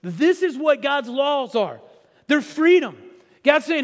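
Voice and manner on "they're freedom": ordinary talk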